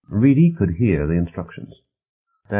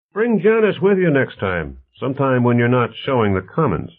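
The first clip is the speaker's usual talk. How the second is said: higher, louder friendly send-off; lively host's voice, lifted in pitch; affable host Bonner; 7 semitones higher, 5 dB louder than usual. Acted. Bonner sees Stevens to the door, lively affable host.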